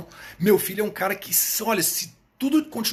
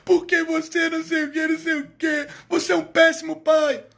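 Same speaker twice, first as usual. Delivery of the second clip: high, loud whining kid voice, mocking; hammed-up whining child; whiny child complaint; 10 semitones higher, 5 dB louder than usual. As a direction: play a bratty kid accusing his dad, much higher. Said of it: "mas eu não tenho do meu filho".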